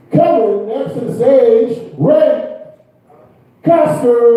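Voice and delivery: stand-up host's hype intro; stage introduction. Real, not acted. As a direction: announce the next act with a big drawn-out name. Host introducing the next act.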